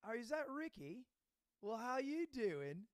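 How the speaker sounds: butter up an acquaintance on the phone; put-on flirty-friendly attendant voice; schmoozing. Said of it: "starts schmoozing a little".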